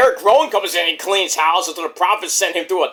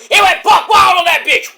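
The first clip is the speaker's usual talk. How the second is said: big excited yell, pitched way up; loud, wild wrestling fan shout; hyped shout; 11 semitones higher, 7 dB louder than usual. Real, not acted. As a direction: holler it out in disbelief. Hyped recap of crazy spot.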